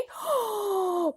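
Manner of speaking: gasp. Acted. Host reacts to cliffhanger.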